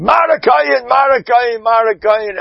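an ecstatic shout that settles; ecstatic cry. Acted. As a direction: cry out in rapture. Crying out the priest's glory.

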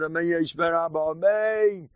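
a lightly voiced devout rabbi answering Amen; imitating fervent response. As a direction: call out the Amen with his whole heart. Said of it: "the way he said".

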